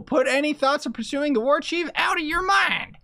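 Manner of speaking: put-on high stern old woman. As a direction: command like an old matriarch. Old woman gives orders.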